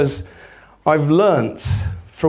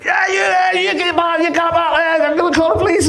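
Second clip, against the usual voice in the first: high-pitched frantic rant with a rough attempt at a Polish accent; mock screaming tirade; imitated screaming. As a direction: rant it high like a panicked man yelling threats. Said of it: "screaming at me, going".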